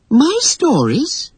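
high, drawn-out astonished question; amazed, voice leaping up; surprised exclamation. A narrator's reading. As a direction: exclaim in girlish disbelief. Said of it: "exclaimed Wendy".

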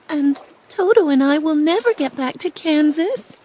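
put-on high, girlish worried question; a young girl fretting at the water's edge; Dorothy's voice. A narrator's reading. Dorothy facing the river, asking in a high worried voice. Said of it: "said Dorothy".

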